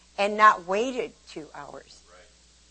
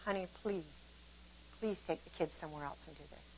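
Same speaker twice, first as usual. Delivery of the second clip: much softer, lower gentle asking; gentle plea; 3 semitones lower, 16 dB quieter than usual. Retold or imitated. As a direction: ask kindly, quietly. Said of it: "would have worked".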